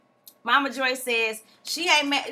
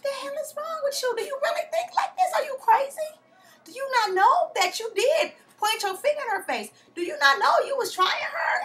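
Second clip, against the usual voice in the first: exasperated mock yelling; 14 semitones higher than usual. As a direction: rant at her in disbelief. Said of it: "You like".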